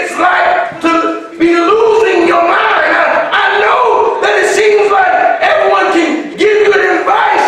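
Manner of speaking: full-force preaching. Acted. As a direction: whoop it up to the congregation.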